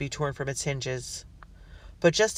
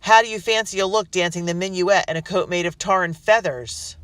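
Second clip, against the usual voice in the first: jeering taunt; 5 semitones higher, 8 dB louder than usual. Acted. Rebel jeering through door.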